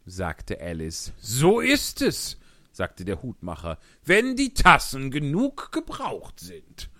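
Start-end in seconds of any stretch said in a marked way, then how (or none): 1.2-6.9 loud, excited Hatter voice. Acted. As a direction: tell it like an agitated Hatter. Hutmacher als Figur. (lauter, aufgeregter Hutmacher)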